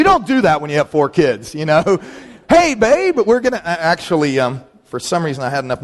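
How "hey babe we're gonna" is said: calling to wife. Retold or imitated. Imagined call to wife.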